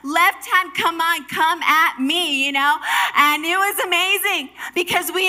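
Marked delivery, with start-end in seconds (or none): 0.0-2.5 loud, high, pumped-up boast; cocky challenge to an opponent; fired up, calling them on; newly confident. Acted. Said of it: "my confidence grew".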